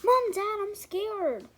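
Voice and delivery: put-on high, loud childish scared voice; mock scared. Daughter telling her parents she's scared, high call.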